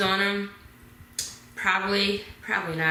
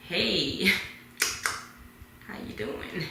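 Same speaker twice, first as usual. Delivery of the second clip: playing cool; 6 dB quieter, slower than usual. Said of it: "I'm trying to play cool".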